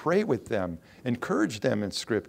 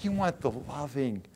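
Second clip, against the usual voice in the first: normal talking voice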